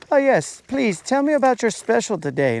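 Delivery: high, mock-fancy diner voice; a customer asking sweetly about specials; posh diner. Viewer playing a restaurant customer, high and mock-fancy. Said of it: "one of you guys is like".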